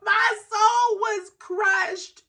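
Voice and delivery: slow, high, loud tearful cry; laugh-crying wail. Real, not acted. Telling of a crushed soul, wailing between laughs. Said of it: "as I cry, as I laugh".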